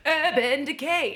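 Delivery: drawn-out mock brand name; put-on, mocking brand-name delivery; making fun. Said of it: "that's why I make fun of it".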